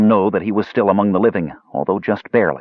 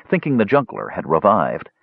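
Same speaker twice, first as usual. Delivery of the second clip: usual speaking voice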